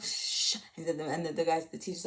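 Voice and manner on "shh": imitated shushing hiss; hushing shh. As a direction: hush someone with a breathy shh. Hushing the loud reply in detention.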